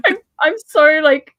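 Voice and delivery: high, loud, laughing while talking; words spill out through real laughter; giggling self-mockery. Real, not acted. Laughing at herself.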